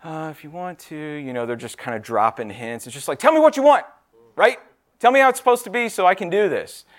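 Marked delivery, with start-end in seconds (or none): 0.0-1.0 soft, hesitant hint-dropping; a boss too timid to say it outright; passive hinting. Retold or imitated. Passive supervisor beating around the bush, quietly hesitant. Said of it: "a supervisor's like". 3.2-6.9 much higher and louder, fed up; exasperated plea. Retold or imitated. Employee fed up with a hinting boss, loud exasperated plea. Frustrated employee demands clear instructions.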